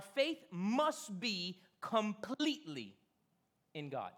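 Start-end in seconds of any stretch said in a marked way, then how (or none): none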